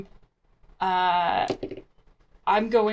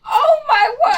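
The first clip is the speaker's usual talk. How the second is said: high loud gasp of alarm; high loud alarmed exclamation; shocked cry; 10 dB louder than usual. Acted. Character sees the firefight.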